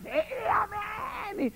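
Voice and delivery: hammed-up swooning response; mock amen cry. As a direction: overwrought congregation amen. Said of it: "un loquero ahí terrible".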